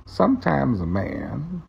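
Morgan Freeman voice. Shown as a demonstration. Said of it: "find a longer phrase".

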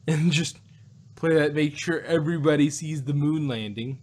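normal talking voice

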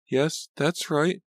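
high, slow, distant admission; cold, distant. A narrator's reading. Laura confirming the breakup to Martha, cold and distant. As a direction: draw it out slowly, keeping her at arm's length. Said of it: "voice suddenly sounded cold, distant".

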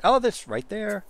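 sudden high, loud oh of discovery; surprised find. Real, not acted. Streamer finally spots the outdoor shower, surprised. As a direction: pounce on the spotted item.

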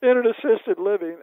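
put-on high, loud bewildered old woman, laughing through it; mock alarm, waking confused; quoting his disoriented friend in a high loud voice; imitating confused Nell. Nell confused, woken from sleep.

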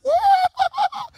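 high, whooping laugh; excited laugh. Real, not acted. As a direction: laugh out high mid-sermon.

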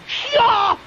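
high sharp mock kung fu cry; martial arts yell. Host acting out the caner's battle cry, high. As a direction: bark it out like a showy fighter. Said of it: "he's walking up and going".

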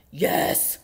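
loud, rough, exasperated bear; exasperated yes. A narrator's reading. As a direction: snap back at the mouse. Bear snaps at noisy mouse.

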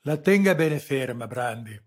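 loud, high, commanding; ordering. A narrator's reading. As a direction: bark it down at the agent. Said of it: "ordinò Sarrese".

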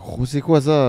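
hammed-up French, playing the confused local; French accent. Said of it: "They have a French accent".

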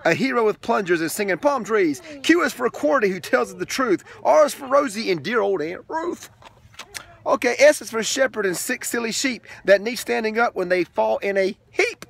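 lilting read-aloud rhythm; sing-song picture book reading; reading to children. Acted. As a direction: read the rhyming book to a toddler.

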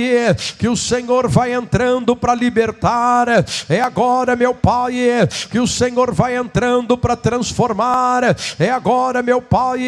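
impassioned preaching prayer, rhythmic cries; fervent prayer. Acted. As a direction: pray it out with fire. Preacher prays at full force.

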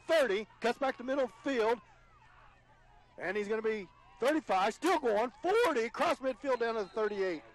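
real thrill, words tumbling out; excited return call. Real, not acted. Play-by-play announcer calling a big return, real excitement. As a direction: voice climbs high and loud as the runner breaks free. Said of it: "got all choked up there".

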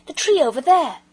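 loud, high urgent call; boy crying out at the moving tree; excited cry. A narrator's reading. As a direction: shout it while pointing. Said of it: "cried Peter suddenly".

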